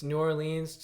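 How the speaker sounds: slow "New Orleans", every syllable sounded; "New Orleans" with a clear "or-leens"; full pronunciation. Shown as a demonstration. Showing how the name flows, "New Orleans" said in full. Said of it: "It just flows".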